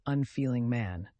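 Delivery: ordinary delivery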